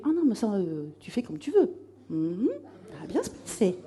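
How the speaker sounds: soft, throwaway imitation of a boss; offhand reassurance. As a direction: brush the question off quietly, lightly imitated. Bosses brushing off her question.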